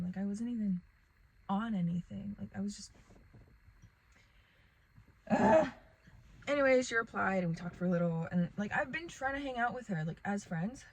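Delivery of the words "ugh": a sudden loud exasperated "ugh"; loud groan. Real, not acted. Telling viewers about her crush, a loud frustrated groan. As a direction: let out a big frustrated groan. Frustrated, trailing off mid-thought.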